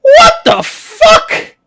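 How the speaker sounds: high, loud startled squeal; shocked shopkeeper shriek. Acted. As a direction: shriek it in disbelief. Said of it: "The shopkeeper looks up and goes".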